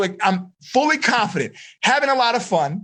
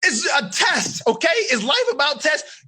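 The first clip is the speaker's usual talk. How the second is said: scolding buddy; 8 semitones higher than usual. Retold or imitated. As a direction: shake a friend out of panic. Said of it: "Mick say".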